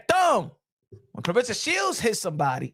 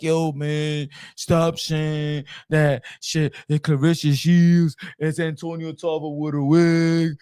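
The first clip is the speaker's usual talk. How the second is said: mock critic voice; 6 semitones lower, slower than usual. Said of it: "y'all be like".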